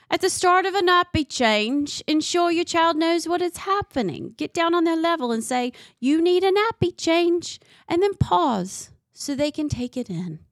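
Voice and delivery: mock Australian instructional voice; attempted Australian accent. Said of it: "that in a British accent".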